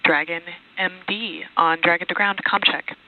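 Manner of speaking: crisp radio transmission over the loop; astronaut radio. Real, not acted. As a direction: talk through a mission radio. Radio comm checks with crew.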